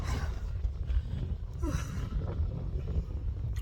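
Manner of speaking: long, soft exhale; winded sigh. Real, not acted. Hiker having second thoughts, winded sigh. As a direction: blow the air out wearily.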